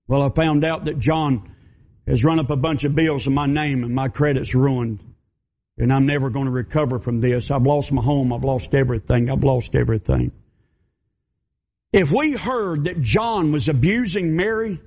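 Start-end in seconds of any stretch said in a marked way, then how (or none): 0.1-10.3 lower, defeated voice sinking into hopelessness; despairing woman. Acted. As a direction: let her give up as she lists her losses. Mary in despair.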